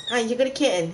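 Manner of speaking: gushing over a tiny kitten; excited. Real, not acted.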